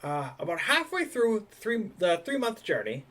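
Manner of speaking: put-on high, chatty old dock hand; high old sailor voice. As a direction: raise the pitch, old sailor rambling. GM voices same NPC.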